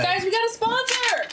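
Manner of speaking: high, excited call to the group; excited announcement. Real, not acted. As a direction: announce it with delight. Announcing the sponsor.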